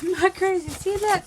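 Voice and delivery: high, indignant little I'm not crazy; mock protest. Vlogger defending herself about the cut side, mock indignant. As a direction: insist you're not losing it. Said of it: "I'm like".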